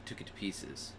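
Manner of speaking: whispered grim ending; hushed, barely voiced. Real, not acted. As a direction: drop to a whisper. Grim end of story.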